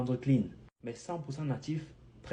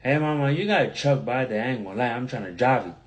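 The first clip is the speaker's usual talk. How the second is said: loud, hammed-up American street accent; put-on American accent melody; 9 dB louder than usual. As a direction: exaggerate the American lilt, loud and playful. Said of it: "Et la mélodie aussi, genre".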